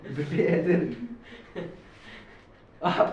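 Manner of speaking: laughing while teasing. Real, not acted. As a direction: chuckle through the complaint. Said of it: "आप चिल्लाए जा रहे हो". (हँसते हुए छेड़ना)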